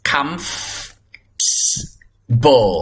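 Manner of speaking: "comfortable" very slowly as "kumf... bo"; broken-down pronunciation. Shown as a demonstration. Teacher breaking the word down for students, slow "comfortable". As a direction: draw out the f in "comfortable", then pause. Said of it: "ออกเสียงว่า".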